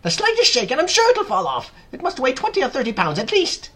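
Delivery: high, loud, agitated warning; Aunt Spiker yelling. A narrator's reading. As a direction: yell the warning shrilly. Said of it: "Aunt Spiker yelled".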